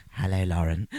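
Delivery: low, put-on creepy greeting; creepy tape voice. Imagining switching on the dictaphone, low creepy voice. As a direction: drop low for a mock-sinister hello. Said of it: "it's just some creepy".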